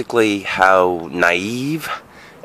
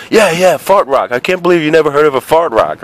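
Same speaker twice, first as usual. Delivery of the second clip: put-on higher, louder, fast teasing kid voices; buddies egging on; 7 semitones higher, 3 dB louder than usual. Buddies backing up the fart rock story, teasing. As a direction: jeer along like a pack of kids. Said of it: "all of his buddies were like".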